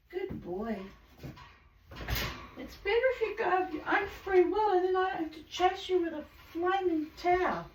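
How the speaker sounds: high, fast sing-song chatter; cooing pet talk. Real, not acted. Owner sweetly scolding her bird about the towel, high.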